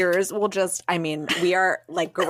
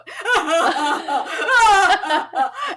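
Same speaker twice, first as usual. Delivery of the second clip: real laugh bursting out high and loud; burst of high laughter; 9 semitones higher, 4 dB louder than usual. Real, not acted. Laughing while telling the theater story, high laugh.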